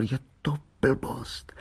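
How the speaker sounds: slow, hushed mutter to himself; soft, drawn-out self-reproach; whispering. A narrator's reading. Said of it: "svalil do trávy a šeptal".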